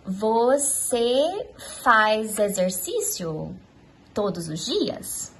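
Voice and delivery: "Você faz exercício todos os dias?" drawn out slowly; slow demo question; slow example question. Shown as a demonstration. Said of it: "Se eu quiser perguntar pra você".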